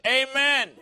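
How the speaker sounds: high, loud mock "amen"; wife's amen. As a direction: belt it out, imitating an exclaiming wife. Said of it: "saying that very same thing".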